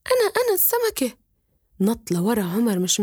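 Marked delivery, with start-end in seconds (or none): 0.1-1.2 high, squeaky little fish voice begging; a small talking fish pleading to go home. Acted. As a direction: beg like a tiny magic fish. Fish answers the boy.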